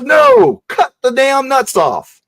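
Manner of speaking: shrieked no, very high, then slow angry order; retold angry shout. Grandfather screams at the boy's timid cut, furious shout. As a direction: explode at the kid for the little nick.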